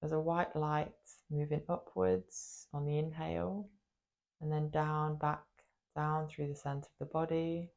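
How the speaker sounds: guided meditation. Acted. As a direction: guide them gently into the body, leaving space after each phrase. Leads a body meditation.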